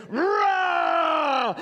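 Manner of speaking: high drawn-out roaring yell; lion roar shout. Acted. Preacher voicing the beast's roar at the sheep lion, long shout. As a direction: roar it like a lion across the river. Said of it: "he hears this".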